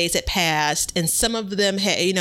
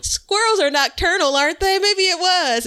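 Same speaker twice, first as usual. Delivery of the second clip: high, louder mock pleading; acted self-quote; 12 semitones higher, 4 dB louder than usual. Recounting a debate with a coworker, high mock insistence. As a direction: argue it in a raised, high-pitched voice. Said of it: "I'm like".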